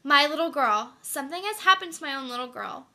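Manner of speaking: a loud, frightened cry over her daughter; alarmed outcry. Acted. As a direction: panic rising for her little girl. Mother realizes something happened.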